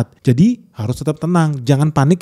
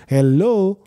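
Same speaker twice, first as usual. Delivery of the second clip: "Hello" pitched higher and exasperated; a bigger, more incredulous "Hello"; sarcastic hello; 4 semitones higher than usual. Real, not acted. Mocking candlestick believers, higher exasperated hello.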